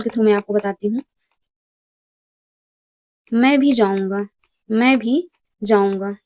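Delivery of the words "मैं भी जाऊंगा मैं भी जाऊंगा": भी said with extra weight, second take slower; stress on भी. Shown as a demonstration. Teacher showing students how भी adds emphasis, stressed. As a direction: lean hard on भी to mark emphasis. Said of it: "इसमें भी लगने से मैं पर जोर आ जाता है".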